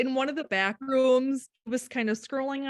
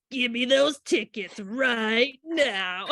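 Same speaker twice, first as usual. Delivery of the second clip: Zootopia bully; 3 semitones higher, 4 dB louder, slower than usual. Retold or imitated. Mother retelling her son's perfectly timed movie line, slow bully voice. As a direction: mimic the bully, stretching the words out. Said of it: "he does the voice exactly like that".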